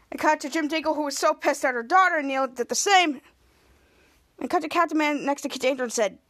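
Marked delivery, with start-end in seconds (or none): none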